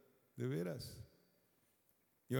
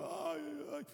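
mock wailing, much higher; imitating crying; 4 dB quieter, slower than usual. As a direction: copy the sobbing churchgoers. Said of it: "yo veía ahí que estaban llorando". (lamento imitado, muy agudo)

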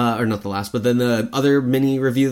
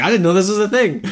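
high, loud delighted disbelief; high loud gleeful amazement; excited surprise; 9 semitones higher, 7 dB louder than usual. Retold or imitated. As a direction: squeal at an unexpected treat. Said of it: "I was like".

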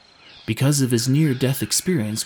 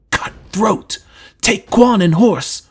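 loud, raised rebuke in forceful bursts; angry scolding; 7 semitones higher, 8 dB louder, slower than usual. Acted. Rescuer scolds Drew about the traitor, loud and angry. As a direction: berate a fool at full voice. Trendon rages at Drew's foolishness.

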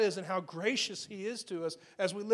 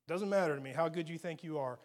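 normal talking voice

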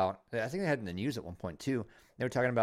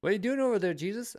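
high, playful nosy neighbor; 10 semitones higher, faster than usual. Pretend. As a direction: call out like a curious neighbor.

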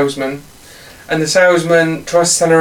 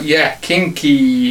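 slow sultry kinky; 3 semitones lower, much slower than usual. Retold or imitated. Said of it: "would you be like".

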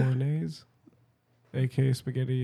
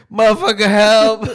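loud, high, hammed-up call for help; mock cry for help; 7 semitones higher, 11 dB louder than usual. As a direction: yell it like a fake emergency.

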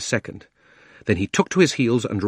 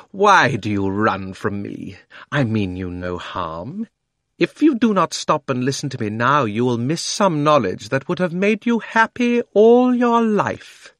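proud, coaxing witch rising to a pitch; tempting witch, growing intense; 4 semitones higher, 5 dB louder than usual. A narrator's reading. Witch character voice.

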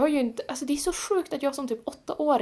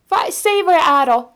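demanding plea; 7 semitones higher, 9 dB louder than usual. Retold or imitated. Återger sin vädjan till någon.

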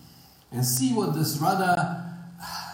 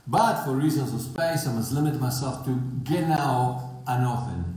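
ordinary talk

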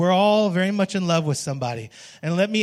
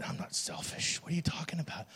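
mock indignant mutter; 14 dB quieter than usual. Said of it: "look at me like".

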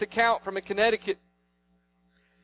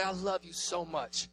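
much softer, slow, close to the ear; in their ear; 10 dB quieter than usual. Retold or imitated. Father squeezing his kids, softly into their ear. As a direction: hush it right into a child's ear. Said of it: "I get right in their ear".

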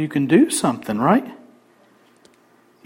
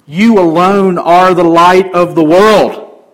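slow, louder ringing proclamation; proclaimed; 2 semitones higher, 6 dB louder, slower than usual. Acted. Pastor lifting his voice to proclaim to the church. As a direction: declare it slowly and loudly. Repeats line, now proclaiming.

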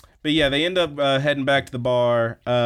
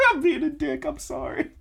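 high, squeaky words through laughter; real helpless laughter, voice pitched high; laughing apology; 3 dB quieter than usual. Real, not acted. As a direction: apologise while cracking up. Laughing after teasing the co-host.

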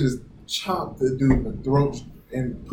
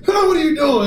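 shocked cry; 12 semitones higher, 5 dB louder than usual. Retold or imitated. Retold arrestee shocked by punch.

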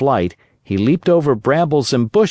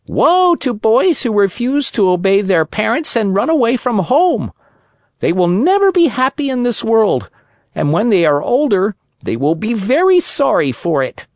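higher, wise cricket giving a warning; lecturing cricket; 10 semitones higher than usual. Acted. Cricket warning the runaway puppet, high lecturing creature voice. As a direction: preach it like a tiny old sage.

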